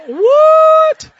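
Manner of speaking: very high, loud drawn-out shriek; outraged disbelief. Retold or imitated. Friend hears he's losing out on first class, mock outraged shriek. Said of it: "is not laughing anymore. He's like".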